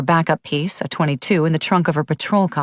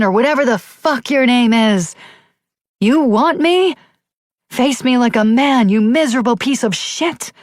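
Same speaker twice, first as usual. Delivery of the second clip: roaring challenge; 8 semitones higher, 3 dB louder than usual. A narrator's reading. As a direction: bellow it at the trees, daring him out. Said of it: "threw back her shoulders and roared".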